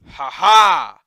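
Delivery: shouted victory laugh; loud triumphant laugh. Real, not acted.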